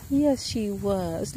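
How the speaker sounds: drawn-out coo to the goat. Real, not acted. Repeated baby talk.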